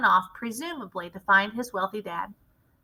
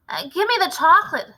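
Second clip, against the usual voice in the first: higher, louder demand; shouting; 7 semitones higher, 6 dB louder than usual. A narrator's reading. Bruno shouts for the chocolate, suspicious. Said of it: "shouted Bruno".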